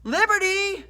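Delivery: high-pitched call for help; yell. A narrator's reading. Rush calls his horse while backing away, high yell. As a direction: yell the name "Liberty" high. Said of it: "I yelled, stumbling backward".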